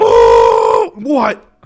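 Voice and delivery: loud startled shout. Real, not acted. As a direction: jump at the name. Startled by name.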